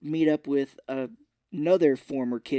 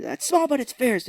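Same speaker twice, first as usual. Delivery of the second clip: high, louder imitated comeback; mock boast; 11 semitones higher, 5 dB louder than usual. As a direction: proudly repeat the line in a raised voice. Repeats favourite line in character.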